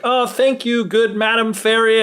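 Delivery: lifted, high and sweet, thanking a fairy; grateful high voice. Retold or imitated. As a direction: merchant thanking an unseen fairy, high and polite. Merchant thanks unseen fairy.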